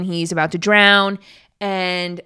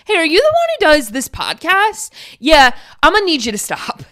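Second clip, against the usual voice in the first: higher, louder, cheeky mock demand; mock stranger; 8 semitones higher, 6 dB louder than usual. Imagined stranger telling the podcaster to stop, mock higher voice. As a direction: play the fan who turns critic.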